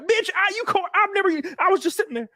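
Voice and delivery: very high, squeaky disbelieving protest; indignant protest. Retold or imitated. Retelling his reply to her accusation, very high and indignant. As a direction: act wronged and flustered, pitch way up.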